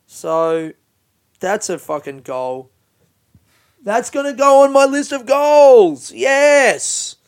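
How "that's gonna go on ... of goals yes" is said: much higher, louder, in a goofy put-on voice; silly put-on voice. Real, not acted. To podcast listeners, declaring a new goal in a goofy voice. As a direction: announce it in a silly cartoonish voice. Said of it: "I don't know why I said it in that voice".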